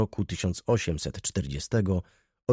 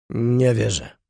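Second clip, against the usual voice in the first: grumbled; 4 dB louder, slower than usual. A narrator's reading. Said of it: "mruknął pan Raczek".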